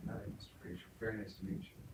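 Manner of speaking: put-on timid little kids meeting the preacher; shy kids. Children nervously greeting the preacher with their puppy, hushed. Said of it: "they say".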